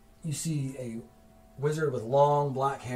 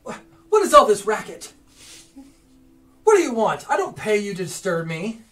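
higher, louder irritated wizard; annoyed wizard; 7 semitones higher, 8 dB louder than usual. Acted. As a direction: bark at the servants. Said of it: "he's like".

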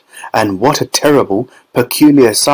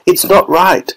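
higher, anxious warning; worried Wonka; 8 semitones higher than usual. A narrator's reading. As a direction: warn her the gum's not ready. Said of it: "said Mister Wonka".

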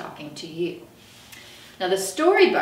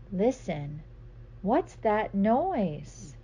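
slow curious question; 3 dB quieter, much slower than usual. Acted. Story refrain.

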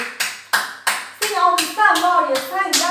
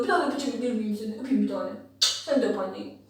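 tender; 7 semitones lower, 7 dB quieter than usual. Real, not acted. Mother cooing to her baby.

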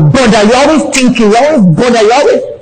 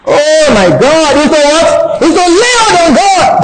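ordinary delivery

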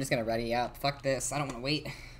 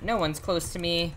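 ordinary talk

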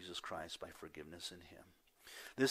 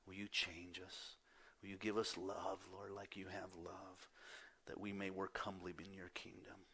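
hushed prayer; 10 dB quieter than usual. Pretend. Believers asking the Lord to change their hearts, soft and whispered. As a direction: pray it barely above a breath. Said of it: "we come to him and say".

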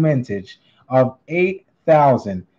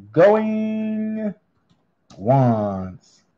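stretched, sing-song going once, going twice; drawn-out auctioneer call; much slower than usual. Acted. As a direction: call it like an auctioneer holding the hammer. Auctioneer calls closing the lot again.

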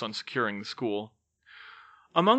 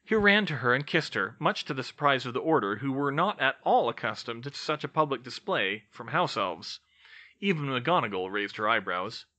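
plain reading voice

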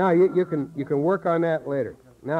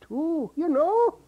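high character voice; 12 semitones higher than usual. Shown as a demonstration. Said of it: "you can sing with these kinds of things".

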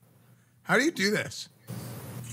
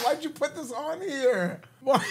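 shouting through laughter; laughing shout; 7 semitones higher than usual. Real, not acted. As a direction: laugh out a protest. Reacting to the aegyo dare.

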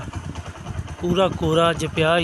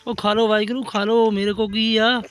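high, louder coaxing; coaxing pets; 5 semitones higher, 5 dB louder than usual. Real, not acted. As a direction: urge the puppies to eat. Coaxing puppies to eat.

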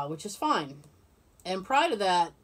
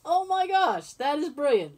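usual speaking voice

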